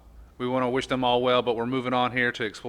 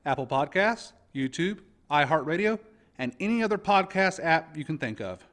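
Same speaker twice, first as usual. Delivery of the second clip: bright, higher announcer voice reading the intro; 7 semitones higher than usual. Acted. Host reading the podcast promo to listeners, announcer style. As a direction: sell it like a radio promo.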